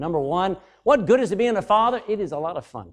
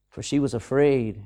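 ordinary talk

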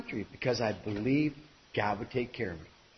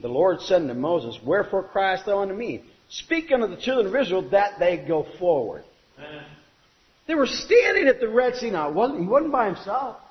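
preaching; 3 semitones higher, 5 dB louder than usual. Acted. Preaching at full force.

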